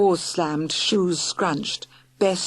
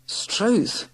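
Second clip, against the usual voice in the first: gasp; 4 semitones higher than usual. Acted. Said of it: "Morris Murgatroyd gasp".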